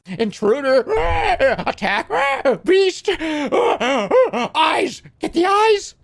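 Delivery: very high, loud screeching bird cries; high loud hawk shrieks at an intruder; angry mother hawk. Acted. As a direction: shriek like a furious guarding hawk. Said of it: "a mother spyhawk rises".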